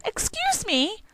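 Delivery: a high small mouse asking politely; timid mouse voice. Acted. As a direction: speak up bravely but small, like a tiny mouse. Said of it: "he gathered up his courage and said".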